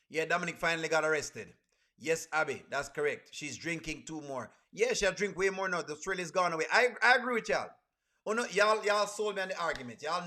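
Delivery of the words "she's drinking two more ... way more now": ordinary talk, nothing marked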